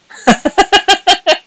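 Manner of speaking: very high, loud squealing laugh; high squeaky laugh. Real, not acted. Laughing at her own "Sini" joke, high squeal. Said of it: "my breath is going like".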